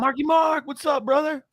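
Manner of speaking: hammed-up high-pitched hail to a buddy. Co-host imagines greeting [name], hammed-up high holler. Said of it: "if I were like".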